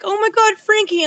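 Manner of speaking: high, loud shocked cry; shocked gasp. Retold or imitated. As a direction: react like he just fell. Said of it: "And you're like".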